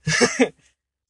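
high, loud, through real laughter; laughing. Real, not acted. Joke teller echoing his friend's remark, laughing. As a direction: say it while cracking up.

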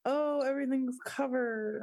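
high-pitched mild amazement; retold surprise. Retelling the snow piling up overnight, mildly amazed. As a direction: act out noticing it piling up.

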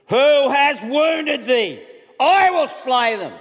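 high, loud, slow roar of rage; furious giant. Retold or imitated. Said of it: "He was furious".

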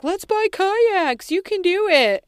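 high, slow, cheery mock voice of her husband; imitating eager husband. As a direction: ham up his pushy enthusiasm. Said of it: "and this guy's like".

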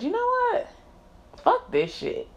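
high, strained voice throwing up her hands; mock exasperated outburst. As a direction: spit it out like storming off. Retold walkout line.

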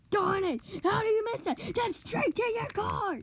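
high, loud exasperated outburst; frustrated shout. Real, not acted. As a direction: yell at the missed shot. Frustrated at a miss.

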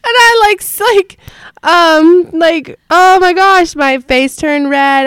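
high and loud through laughter; laughing. Real, not acted. Host still laughing while telling the class story. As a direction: gasp it out while cracking up. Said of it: "I busting out laughing".

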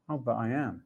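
ordinary delivery

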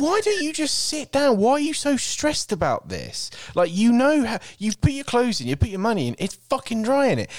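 high, exasperated mock rant at the guy; high-pitched mock exasperation; exasperated inner rant. Retelling annoyance at dryer guy.

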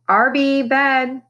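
put-on calling a child to bed from afar. A narrator's reading. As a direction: call him off to bed. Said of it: "Boomer called".

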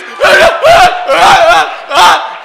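loud, high mock sobbing; comic big-man blubbering; imitated violent weeping. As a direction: bawl in hammed-up heaving bursts. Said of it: "and all she sees is".

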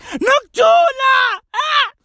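high-pitched hammed-up wail; mock crying. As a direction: imitate a hysterical crying mother. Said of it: "as the lady's crying there".

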